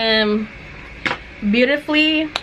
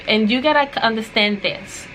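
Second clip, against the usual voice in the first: ordinary talk, nothing marked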